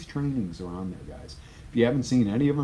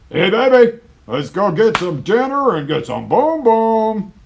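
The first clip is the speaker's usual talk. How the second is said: much higher and louder, slow cheesy come-on; hammed-up pushy date; 15 dB louder than usual. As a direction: overplay a sleazy pickup line. Mocks a pushy date approach.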